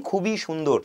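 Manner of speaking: usual speaking voice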